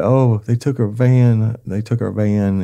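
loud, high-pitched imitation of a crying wife; imitated tearful outcry; mock wailing. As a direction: wail it out, drawn out, mimicking her upset. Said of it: "she started crying".

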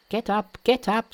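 high-pitched Monkey order; brisk Monkey. Acted. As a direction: urge the kneeling priest up in a high voice. Monkey bids old priest rise.

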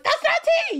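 very high, hammed-up indignation; mock-outraged squeal. Retelling the story to friends on the train, squealing in mock outrage.

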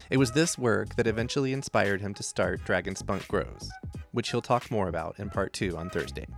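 smooth scripted podcast intro; podcast host read. A narrator's reading.

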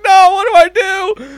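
mock panic. Stranded with a blaring horn, high panicked plea. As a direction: squeal it in helpless panic.

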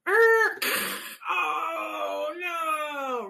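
high, loud, hammed-up oh no; mock crash dismay. Shown as a demonstration. Therapist showing a kid a playful toy car crash, hammed-up dismay. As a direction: ham up the shock of the crash. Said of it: "car crash into the ball and go".

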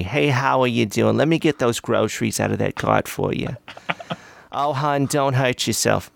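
a light Brooklyn cashier voice; chatty Brooklyn lady, lightly put on; Brooklyn accent. Helping customers at work, light Brooklyn accent. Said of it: "And I talked that way".

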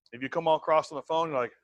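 ordinary delivery

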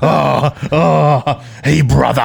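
light Hulk Hogan growl "hey, brother"; Hulk Hogan impression. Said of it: "el Hulk Hogan". (imitación ligera de Hulk Hogan)

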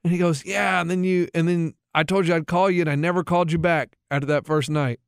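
ordinary talk

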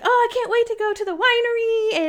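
high, gushing mock excitement; giddy. Retelling the camper drive to a winery, giddy and high. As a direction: squeal it like you can hardly wait. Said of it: "I was giddy. Like, I was irrationally happy".

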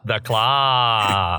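lightly voiced little alien. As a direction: say it like a tiny three-eyed alien. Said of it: "in the alien voice".

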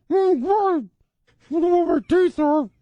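put-on high old lady voice with lost teeth; flustered granny after a skydive; loose dentures. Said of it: "Missus Sullivan?".

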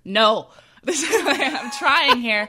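slow, laughing through the words; laughing protest. Retold or imitated. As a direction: plead while laughing. Retelling her protest to friends.

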